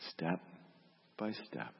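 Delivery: normal talking voice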